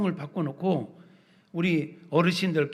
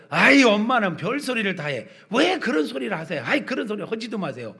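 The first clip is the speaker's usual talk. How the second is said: higher-pitched, exasperated protest; fond scolding; 4 semitones higher, 3 dB louder than usual. Acted. Grown child to mother who talks of dying, exasperated protest. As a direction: brush it off with affectionate dismay.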